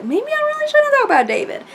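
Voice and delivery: high-pitched, loud mock doubt; put-on high voice, comically unsure; mock second thoughts. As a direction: squeal out a pretend reconsideration. Mock second thoughts about topic.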